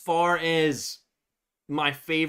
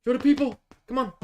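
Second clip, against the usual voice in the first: high pet talk; 10 semitones higher, much faster than usual. Real, not acted. Owner urging dog to face the camera, high-pitched sing-song "Show the people".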